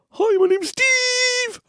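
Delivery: very high, slow put-on toy voice; Steve the hippo voice. Dad imitating daughter voicing her hippo, very high and slow. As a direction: squeak it out like a stuffed hippo. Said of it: "she'd do the voice".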